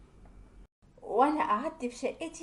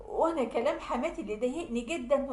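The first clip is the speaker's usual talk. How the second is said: ordinary delivery, nothing marked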